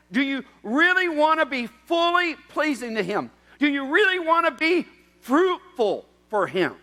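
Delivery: high, loud impassioned preaching; fiery pulpit appeal; preaching at full force. Acted. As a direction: drive each question home.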